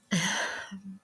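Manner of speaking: a soft, high, shaky sigh; quiet teary breath out; tearful sigh. Real, not acted. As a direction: sigh through tears. Sigh while crying.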